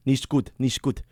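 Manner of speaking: "Nicht gut" in a mock German accent; clipped German "Nicht gut" from Duolingo. Shown as a demonstration. Showing off Duolingo German, mock German accent. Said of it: "That's German, by the way".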